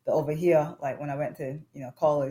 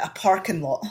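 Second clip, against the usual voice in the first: louder, higher American-style "parking lot"; American phrasing; 5 semitones higher, 8 dB louder than usual. Shown as a demonstration. Showing the host how Americans say it, American accent. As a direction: demonstrate it as Americans say it. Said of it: "How do they say it?".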